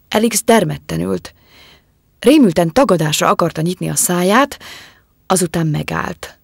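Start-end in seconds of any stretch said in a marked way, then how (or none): none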